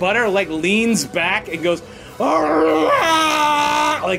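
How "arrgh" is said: long, high roaring bellow; drawn-out animal war cry; guttural bull bellow. Acted. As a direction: let out a horrendous bull's roar. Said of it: "bellowing out, like, completely gutturally".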